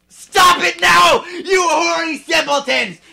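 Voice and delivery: high, loud outraged shout, slowed. Acted. Professor scolding caught students, shouting high and loud. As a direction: bellow at the students to stop. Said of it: "shouted Professor McGoggle".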